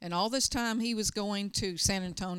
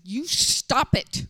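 commanding shout; 4 semitones higher, 8 dB louder than usual. Retold or imitated. Pastor commanding the crying deacon, firm shout. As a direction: order him to stop it. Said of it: "and I said".

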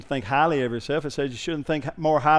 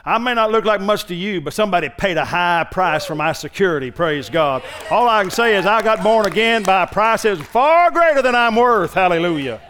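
louder, higher preaching voice for the car; the little car preaching; 4 semitones higher, 6 dB louder than usual. Acted. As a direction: let the little car testify with joy. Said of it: "That little car was sitting there saying".

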